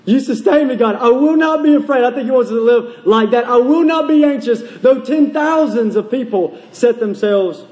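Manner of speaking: fervent scripture proclamation; impassioned preaching. Real, not acted. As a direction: declare the verse with conviction. Preaches verse forcefully.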